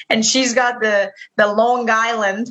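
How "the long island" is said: put-on Long Island accent. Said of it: "that was a terrible New York accent".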